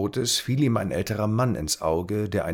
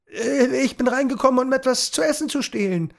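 put-on high, louder nervous boy; Tom explaining; 5 dB louder than usual. A narrator's reading. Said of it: "erklärte Tom". (nervös erklärend)

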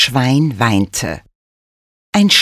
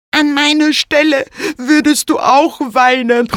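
high, sobbing pig voice; sobbing and grunting; 10 semitones higher than usual. A narrator's reading. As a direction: sob and grunt it like a sad pig. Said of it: "schluchzte und grunzte das Schwein".

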